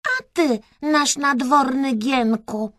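high-pitched royal address; Kreska as princess. A narrator's reading. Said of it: "zwróciła się Kreska do gołębia".

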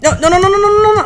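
frantic rising no, no, no; panicked high no's. Real, not acted. Panic as blocks vanish.

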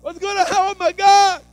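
very high, loud, frantic worrying; panicked worrier. Retold or imitated. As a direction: fret in a high panicked squeal. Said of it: "and you're like".

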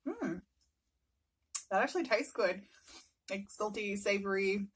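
talking with food in mouth; muffled between bites; chewing. Real, not acted. Seen 0: Took noodles at -1; lips pressed, jaw working between words.

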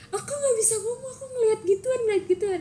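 high, hammed-up sobbing child voice; exaggerated crying. Retelling crying to mom after sleep paralysis, high hammed-up sob. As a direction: wail it like a scared kid, overdone. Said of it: "lebaynya gue kayak".

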